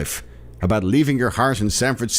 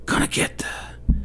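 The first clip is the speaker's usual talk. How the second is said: slow creepy whisper; whispered Biden mumble; mock whisper; 4 dB quieter, slower than usual. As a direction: whisper it like a confused old man. Biden impression whispered aside.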